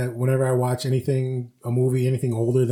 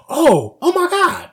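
high, loud, drawn-out gasp of recognition; shocked realization; 14 semitones higher, 5 dB louder, slower than usual. Retold or imitated. Rewatching childhood movies as an adult, mock shock. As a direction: act out the penny dropping.

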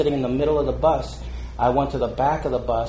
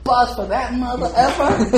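mock teammate jab; 10 semitones higher than usual. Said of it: "And he goes".

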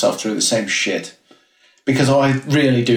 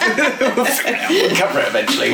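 high, slow, said through laughter; laughing; 11 semitones higher, slower than usual. Real, not acted. Co-hosts joke that they always digress, laughing. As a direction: giggle it out.

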